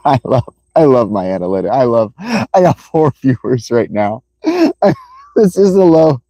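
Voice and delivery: helpless laughter breaking up the words; laughing. Real, not acted. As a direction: keep cracking up, restart the sentence through giggles. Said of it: "That's why I'm laughing".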